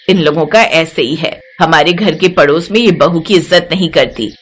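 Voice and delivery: a calm elder guiding the new bride; mother-in-law waves off the neighbours to the new bride; older mother-in-law voice. A narrator's reading.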